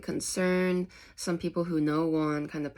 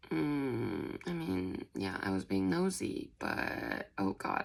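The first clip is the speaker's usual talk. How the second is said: sheepish; 9 semitones lower, 5 dB quieter, slower than usual. Retold or imitated. Plang caught out.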